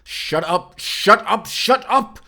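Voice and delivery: embarrassed, shouting her down harder and harder; escalating flustered yell. Acted. Teased about his romantic feelings.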